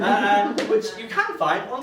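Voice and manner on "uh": normal talking voice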